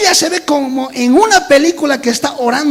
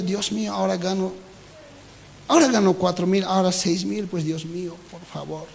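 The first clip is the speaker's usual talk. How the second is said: muttered prayer; 4 semitones lower, 8 dB quieter than usual. Retold or imitated. Imitated whiny prayer.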